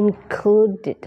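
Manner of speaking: included with a clear -ded ending, slightly high; extra syllable. Shown as a demonstration. Teacher drilling the final ed in included. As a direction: sound out the ed after the D. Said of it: "เติม อีดี หลัง D ออกเสียงเพิ่มอีกหนึ่งเสียง".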